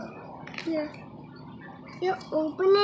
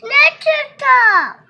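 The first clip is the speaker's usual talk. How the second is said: loud; 6 semitones higher, 13 dB louder than usual. Real, not acted. Child shouting toy names.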